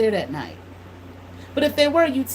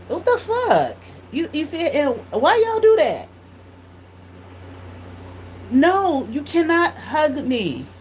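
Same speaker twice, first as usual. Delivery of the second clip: retold indignation, pitched up and drawn out at the end; indignant outburst; 7 semitones higher, slower than usual. Church member asks for hug, retold indignant refusal.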